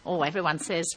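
ordinary talk, nothing marked

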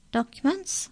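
high-pitched official demand. A narrator's reading. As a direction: demand it as a pointed, rising question. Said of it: "demanded the incisor".

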